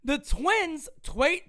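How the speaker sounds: ordinary delivery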